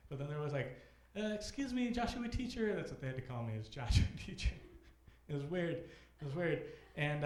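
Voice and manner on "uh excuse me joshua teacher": put-on higher, small kid's plea; mock kid voice. Imitating a student asking the teacher, in a higher kid voice. Kids quoted addressing teacher.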